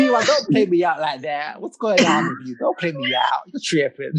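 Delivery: slangy mock-American complaint; British speaker hamming up American slang; American accent. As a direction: playfully protest in a put-on American accent. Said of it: "in your best American accent".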